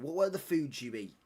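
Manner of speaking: ordinary talk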